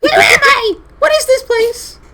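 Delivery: very high, loud squeal; creature voice. Acted. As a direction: shout it in a tiny shrieky creature voice. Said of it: "he'll say".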